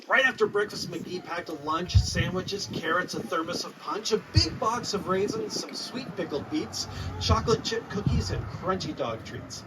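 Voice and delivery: usual speaking voice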